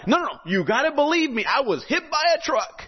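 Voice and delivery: put-on a desperate kid begging to be believed; young man insisting to the pastor, high and pleading. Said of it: "the pastor's like".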